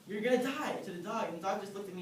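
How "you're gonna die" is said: playful raised mock threat to the dog; higher and louder, a joking warning; half-serious threat to a misbehaving dog. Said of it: "So I was just like".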